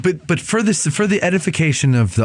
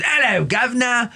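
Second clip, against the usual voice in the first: Cockney cab driver; 12 semitones higher than usual. Retold or imitated. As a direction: drop the h like a London cabbie. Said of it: "And then the cab drivers".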